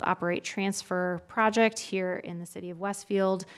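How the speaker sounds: ordinary talk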